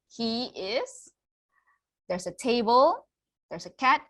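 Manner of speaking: ordinary delivery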